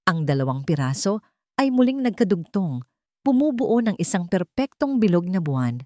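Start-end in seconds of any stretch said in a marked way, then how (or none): none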